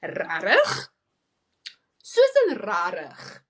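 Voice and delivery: slow, drawn-out Susan rarig with a far-off voice; mock a scornful South African reply; disapproving rarig. Friend mocking a reckless taxi driver, drawn-out scorn. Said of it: "she's like".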